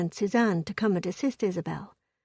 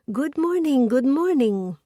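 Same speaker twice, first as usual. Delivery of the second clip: put-on lightly French-accented call, higher and louder; 5 semitones higher, 5 dB louder than usual. A narrator's reading. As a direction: greet them brightly from the back, French style. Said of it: "a voice thick with a French accent called".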